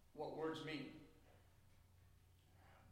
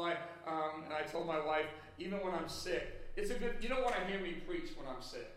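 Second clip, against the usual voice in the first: ordinary talk, nothing marked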